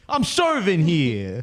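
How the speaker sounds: put-on slow New York tennis-player accent; New Yorker at Niagara Falls playing tennis, in a New York accent. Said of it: "you're a New Yorker".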